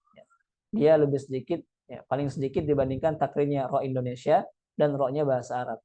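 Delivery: ordinary talk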